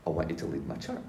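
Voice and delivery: understated retelling of a divine instruction; Jesus speaking. Retold or imitated. Jesus calls the speaker to lead, quiet and measured. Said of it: "he says".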